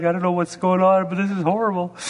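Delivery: put-on a kid lost in his first practice; bewildered kid. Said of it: "All these kids are like".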